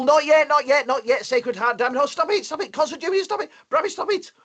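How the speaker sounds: high, flustered telling-off; mock scolding. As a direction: tell off classes for jumping the gun. Teacher telling off classes for answering early.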